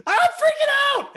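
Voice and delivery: very high, loud panicked outburst; freaking out. Shown as a demonstration. Coach shows a frantic paraphrase for an acting line. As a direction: explode in panic. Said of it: "then you go".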